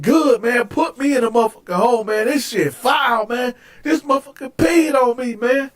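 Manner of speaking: high, exasperated protest; indignant retort. Retold or imitated. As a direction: re-enact defiant griping to guards. Retold retort to guards.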